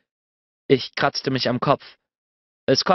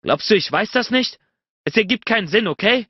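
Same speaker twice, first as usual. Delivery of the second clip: higher, urgent plea; heated reply; 7 semitones higher, 3 dB louder than usual. A narrator's reading. Bennett defends himself to her, heated and urgent. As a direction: push back, pleading hard.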